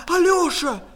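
loud, high-pitched yell; alarmed shout. Acted. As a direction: call out urgently to your fallen brother. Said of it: "кричала она".

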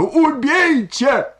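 very high, drawn-out shriek; piercing, full-force anguished cry; desperate scream. A narrator's reading. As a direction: scream it out in hysterics. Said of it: "крикнула Ольга Ивановна".

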